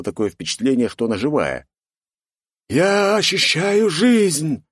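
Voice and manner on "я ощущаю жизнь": slow, high, ghostly sepulchral voice; dark lord. A narrator's reading. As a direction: drag it out like a voice from the grave. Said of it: "за могильный голос".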